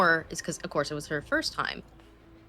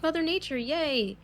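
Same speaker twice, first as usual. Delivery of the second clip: excited; 9 semitones higher than usual. Retold or imitated. Mimicking excited Mav.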